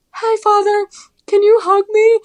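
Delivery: put-on very high, tiny little-girl voice; small pleading voice. Asking priest for hug while crying.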